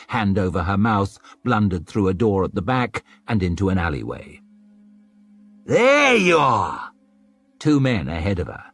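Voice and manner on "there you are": slow, high, loud gotcha shout; catching the fleeing girl; triumphant shout. Acted. Pursuers spot Savine.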